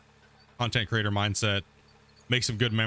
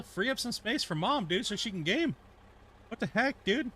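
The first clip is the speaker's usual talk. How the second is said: high, softer playful scolding of the cat; pet talk; 9 semitones higher, 4 dB quieter than usual. Real, not acted. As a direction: tease the cat sweetly, much higher. Talking to the cat.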